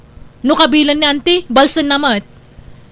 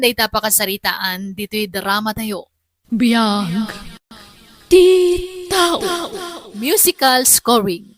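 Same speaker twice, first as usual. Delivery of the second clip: drama outro narration; 6 semitones lower than usual. A narrator's reading. Narrator closing the drama and reading credits, in a low measured voice. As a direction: close the story like a radio drama host.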